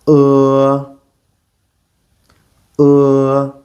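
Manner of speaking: "Uh" with mouth slightly open, tongue curled back; "Uh" tongue pulled back; Mandarin e vowel. Shown as a demonstration. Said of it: "keep your mouth slightly open and".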